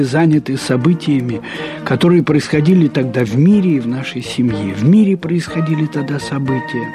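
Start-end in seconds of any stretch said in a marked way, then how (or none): none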